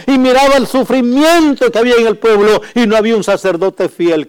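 high, loud impassioned sermon; preaching at full force. Acted. Preacher telling of Ana, full-force preaching. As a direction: keep pressing the story hard.

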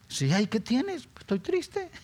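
high squeaky pretend sadness; mock sad whimper. Preacher imitating a sad person asked what's wrong, high mock whine. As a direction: play the sulking sad one, whining high.

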